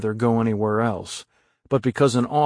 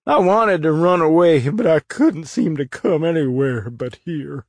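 sobbing; 5 semitones higher, 4 dB louder than usual. Acted. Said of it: "fell to sobbing again".

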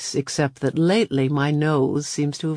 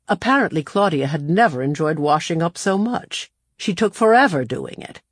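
usual speaking voice

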